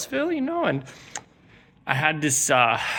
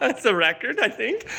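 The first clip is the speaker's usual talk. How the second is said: laughing; 6 semitones higher than usual. Real, not acted. Laughing at own joke.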